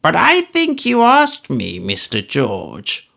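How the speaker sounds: high, slow, creaky-old-man voice put on over fake pleasantness; an old miser pretending to be agreeable; old Smallweed voice, high and slow, pretending good humour; cranky old man, mock good temper.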